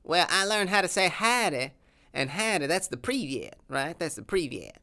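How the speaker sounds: lightly voiced Texan accent, proud of his sauce; a hint of a boastful Texas cowboy; southern accent.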